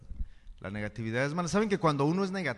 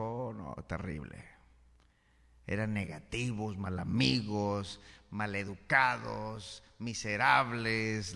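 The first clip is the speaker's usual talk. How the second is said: gloomy complaint; 5 semitones lower, slower than usual. Retold or imitated. Forastero describe su pueblo.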